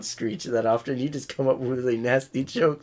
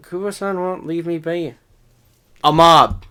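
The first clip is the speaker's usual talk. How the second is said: put-on southern accent; 4 semitones higher, 7 dB louder than usual. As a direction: lay on a thick southern accent. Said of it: "What was with the heavy southern accent there?".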